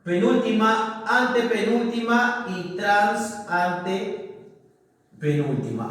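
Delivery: slow, drawn-out list of positions; counting off syllables. Shown as a demonstration. Teacher showing syllable positions to the class, counting them off slowly. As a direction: stretch each term out slowly. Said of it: "Sería así".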